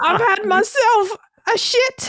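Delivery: high, slow, hammed-up British; mock British accent. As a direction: drag out a silly posh English line. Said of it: "I don't know what British people do".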